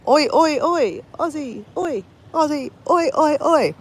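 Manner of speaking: high-pitched mock crowd chant; Aussie cheer. Streamer greets Aussie viewers with the national cheer, playfully chanting. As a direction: chant it high without really yelling. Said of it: "war cheer".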